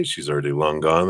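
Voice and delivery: usual speaking voice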